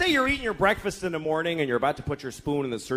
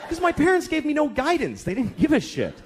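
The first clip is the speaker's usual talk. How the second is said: high-pitched, aggrieved rant; bitter outburst; 9 semitones higher than usual. Acted. Father blaming his parents to his daughter, bitter outburst. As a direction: let the old grievance burst out.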